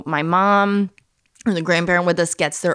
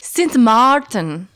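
slow, louder, higher Dutch-accented Saint Martin; Dutch pronunciation; 5 semitones higher, 5 dB louder, much slower than usual. Shown as a demonstration. Wife showing husband another way, Dutch accent. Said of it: "It's Saint Martin in the Dutch".

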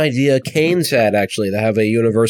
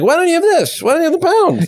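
very high mocking falsetto; mock TV host. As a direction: squeak it out like a smug pundit. Mocking TV host's question.

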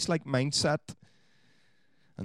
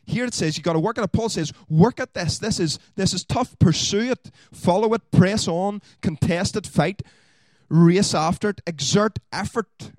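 high, loud, driving preacher's exhortation; fiery pulpit preaching, louder and higher; preaching at full force; 9 semitones higher, 8 dB louder than usual. Acted. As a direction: hammer each command home like a rallying cry. Preaching Paul's call to effort.

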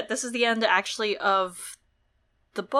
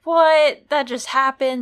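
louder, high disbelieving outburst; shocked reaction; 5 semitones higher, 9 dB louder, slower than usual. Retold or imitated. Reader retelling shock at Ned's death, stunned. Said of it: "I was like".

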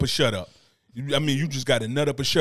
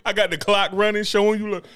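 usual speaking voice

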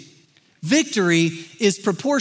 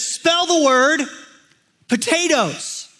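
raised, slow announcing voice; higher, drawn-out quizmaster delivery; spelling bee moderator; 5 semitones higher, slower than usual. Acted. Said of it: "moderator looks at me and he says".

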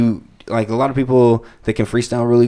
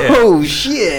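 mock amazement; 11 semitones higher than usual. Playing the crowd stunned by a basic bar, mock shock. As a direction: react like it blew your mind.

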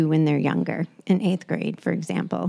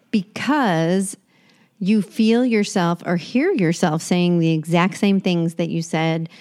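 usual speaking voice

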